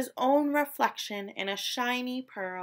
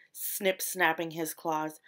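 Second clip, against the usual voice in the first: plain reading voice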